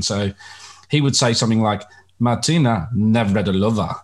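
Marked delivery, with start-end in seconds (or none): 2.2-3.9 put-on commentator breaking into a Czech-style accent; imitated Czech accent on the name; imitated ethnic pronunciation. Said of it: "he'd almost go into that ethnic, uh, pronunciation".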